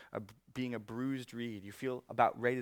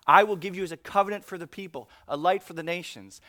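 louder, fast, pushed verse; preaching loud; 5 semitones higher, 13 dB louder than usual. Real, not acted. Pastor rereading the verse to the congregation: fast, loud preaching. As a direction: drive the verse home.

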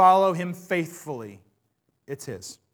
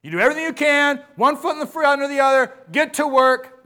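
higher and fast, pressing the congregation on; mock drill-sergeant preacher urging the crowd; rousing pulpit push; 8 semitones higher, 8 dB louder than usual. Shown as a demonstration. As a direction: drive them forward like a coach yelling from the sideline. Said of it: "I'm not trying to sound like a drill sergeant".

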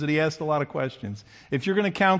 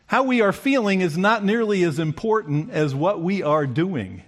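usual speaking voice

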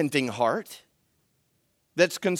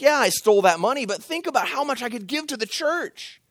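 1 mock rationalizing; 8 semitones higher than usual. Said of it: "It's like".

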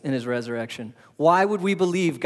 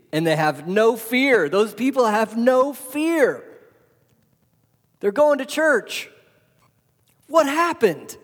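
building, slower, then much higher; rising fervor; 7 semitones higher, 3 dB louder, slower than usual. Acted. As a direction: preach it up to a peak. Preaching climax on fearless believers.

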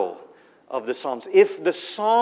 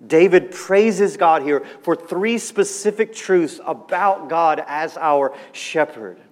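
ordinary talk, nothing marked

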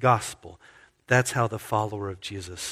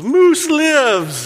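much higher, loud triumphant shout; joyful yell; 9 dB louder than usual. Retold or imitated. Cody shouting over the cubicles to coworkers, joyful yell. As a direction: announce it to the whole office. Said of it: "stood up and yelled above the cubicles".

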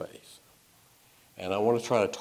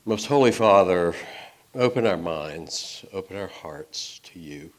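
prayer; 3 semitones lower than usual. Acted. Opening prayer before sermon.

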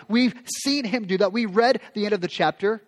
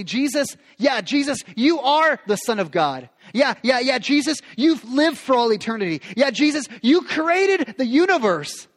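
higher and louder, mock-patronizing yeah yeah; sarcastic dismissal; 5 semitones higher, 4 dB louder than usual. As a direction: brush Jesus off with sarcasm. Mocking his own complaint.

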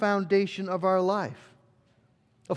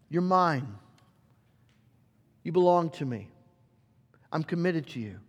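tender father; 3 semitones lower than usual. Acted. Dad speaking tenderly to his kids.

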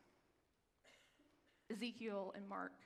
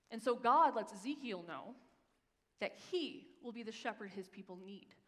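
ordinary talk

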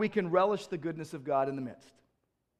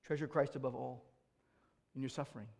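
hushed devotion; 5 semitones lower, 12 dB quieter than usual. Acted. Quiet devotional close.

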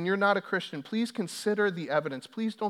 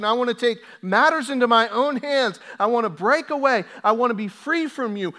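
loud, higher sermon climax; preaching at full force; 8 semitones higher, 11 dB louder than usual. Acted. Pastor warning the congregation about rejecting God, full-force preaching. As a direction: voice the rebel, then slam the verdict.

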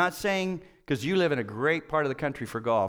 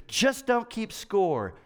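slow, higher mock of silly advice; mocking quote; 6 semitones higher, much slower than usual. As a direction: parrot the advice slowly, pitched up. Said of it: "someone would say something even more stupid".

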